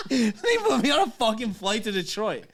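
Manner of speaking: real laughing incredulity; laughing in disbelief. Real, not acted. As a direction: laugh through the complaint. Laughs telling his travel mishap.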